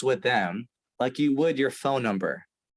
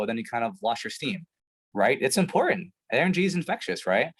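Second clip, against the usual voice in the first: normal talking voice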